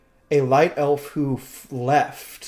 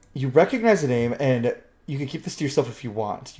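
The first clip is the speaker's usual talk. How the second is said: usual speaking voice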